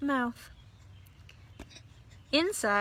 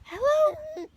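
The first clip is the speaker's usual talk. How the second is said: very high, small voice; a little creature peeping hello; high squeaky hello; tiny high greeting. A narrator's reading. Eulalie greets the doll.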